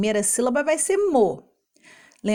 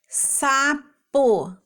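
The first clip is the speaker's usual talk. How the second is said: SAPO split into sa... po, higher; syllable by syllable; 4 semitones higher than usual. Shown as a demonstration. Teacher reading a partial fruit name, SAPO split in two. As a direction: sound out SAPO as two separate pieces. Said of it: "complete os nomes de frutas com as sílabas".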